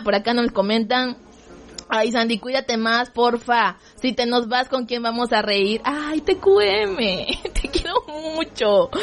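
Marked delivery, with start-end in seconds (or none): 5.8-8.9 high, slow, sugary reading of the message; babyish, drawn-out fond read; cutesy read. Pretend. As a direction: coo it like a sweet note to a friend. Reading a fan's sweet message.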